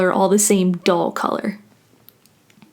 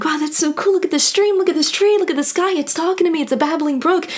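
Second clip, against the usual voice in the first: higher, giddy mock amazement; gushing wonder; 6 semitones higher, faster than usual. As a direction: gush like a child seeing everything new. Mocking a wide-eyed nature lover.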